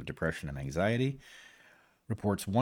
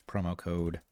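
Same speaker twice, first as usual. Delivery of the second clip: ordinary talk, nothing marked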